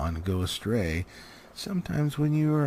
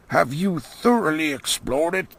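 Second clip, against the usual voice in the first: the wizard; 7 semitones higher, 5 dB louder than usual. Acted. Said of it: "said the wizard".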